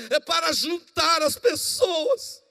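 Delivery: high, slow, shouted declaration; bold shout. Acted. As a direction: proclaim it with fervour.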